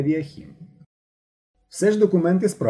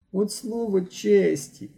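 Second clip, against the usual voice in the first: high, slow whimpering plea; whimpering, much higher and slow; 9 semitones higher, much slower than usual. Acted. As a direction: whine it like a frightened boy. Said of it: "захникав Ігор".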